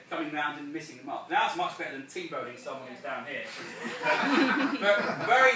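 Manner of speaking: ordinary talk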